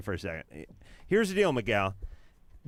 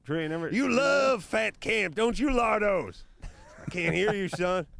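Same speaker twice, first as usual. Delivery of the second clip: drill sergeant; 8 semitones higher than usual. Acted. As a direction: bark at the recruit. Drill sergeant taunts Drew.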